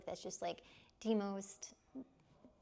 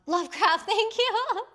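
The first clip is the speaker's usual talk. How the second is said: much higher, loud burst of relief; relieved excitement; 12 semitones higher, 13 dB louder than usual. Real, not acted. Chat finally names the author, excited and relieved. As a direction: shout thanks as the name finally comes.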